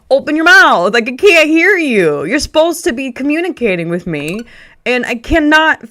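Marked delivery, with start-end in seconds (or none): 0.1-4.4 higher and louder, fed up with a mumbler; exasperated call. Retold or imitated. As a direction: demand he speak up. Retold complaint at mumbling worker.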